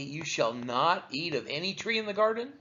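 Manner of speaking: normal talking voice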